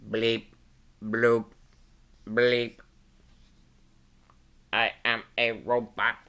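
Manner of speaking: a hint of a stiff robot. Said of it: "when Robot Pete speaks, he says".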